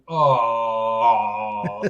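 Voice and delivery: long, low moan of mock pleasure; imitated food moan. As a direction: groan low as if savoring a bite. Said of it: "then we'd just be making, like".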